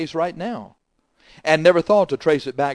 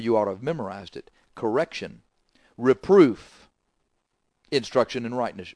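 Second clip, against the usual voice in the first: normal talking voice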